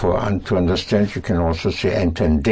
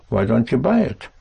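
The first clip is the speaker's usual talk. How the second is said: ordinary delivery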